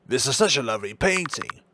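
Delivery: a touch of the doctor's voice; the doctor remarking on a painting, lightly voiced; doctor character voice. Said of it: "I'm gonna drop this voice".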